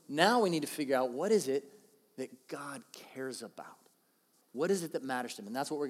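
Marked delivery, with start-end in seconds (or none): 2.2-3.8 slow hushed whisper. Real, not acted. Preacher whispering what God cares about. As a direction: breathe it slowly as a secret. Dramatic hushed sermon point.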